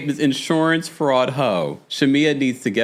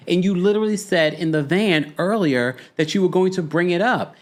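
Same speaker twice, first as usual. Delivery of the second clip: ordinary delivery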